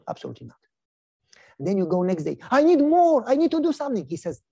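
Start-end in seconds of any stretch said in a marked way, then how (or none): 2.5-4.0 high, insistent plea for more; urgent demand. Acted. Believer returns to the giver begging to do more, urgent. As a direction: press the plea, pitched up and louder. Eager believer begs for work.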